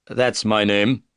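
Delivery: ordinary delivery, nothing marked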